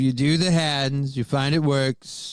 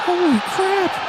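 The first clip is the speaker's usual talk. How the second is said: amazed shout; 5 dB louder, slower than usual. Real, not acted. Watching an insane golf chip.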